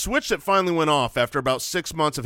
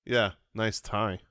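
deadpan, slow and softer mock compliment; no inflection; 5 semitones lower, 5 dB quieter, slower than usual. Shown as a demonstration. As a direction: drop it low and toneless, like an accidental insult. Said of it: "with no inflection".

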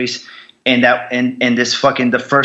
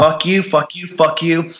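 mild angry replay, repeated a little higher; retold pissed-off defiance; 4 semitones higher than usual. Veteran recalling cursing those who left him, mildly angry. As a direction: repeat it with contained anger, not shouted. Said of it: "So I got fucking pissed off".

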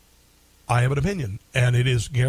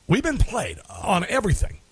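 ordinary delivery

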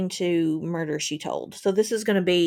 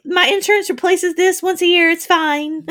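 high, louder fake-carefree reply; mock breezy cheer; 10 semitones higher, 6 dB louder than usual. Host pretends the windshield is no bother, breezy mock cheer. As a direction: brush it off like nothing matters.

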